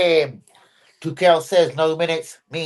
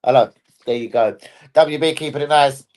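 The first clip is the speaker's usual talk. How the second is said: usual speaking voice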